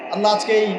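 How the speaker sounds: usual speaking voice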